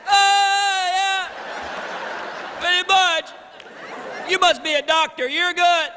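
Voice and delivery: high, strained, airless squeal; wind knocked out. Acted. Winded man answering his friends, high strained sarcasm. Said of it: "Did you get the wind knocked out of you?".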